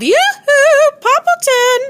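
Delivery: high loud sing-song yoo-hoo; yelled call. A narrator's reading. Neighbor yelling from the window in a high loud call. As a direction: yell it out the window. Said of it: "stuck her head out the window and yelled".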